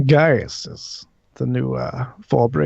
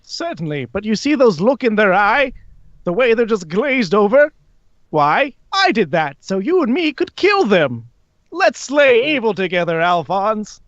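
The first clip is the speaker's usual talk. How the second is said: high, swooping theatrical villain; high character voice, grandly persuasive; Alastor, smugly reassuring; 8 semitones higher than usual. Acted. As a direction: lilt it high with showy confidence. Plays Alastor.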